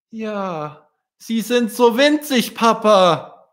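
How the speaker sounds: put-on high, slow wondering child's voice; a little swan marvelling at tiny people; amazed child. Stefan answering father.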